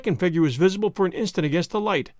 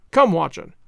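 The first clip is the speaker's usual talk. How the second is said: urgent call; 5 dB louder than usual. A narrator's reading. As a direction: Come punched louder, falling. Urging pursuit.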